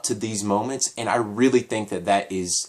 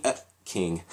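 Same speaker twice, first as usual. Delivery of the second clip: usual speaking voice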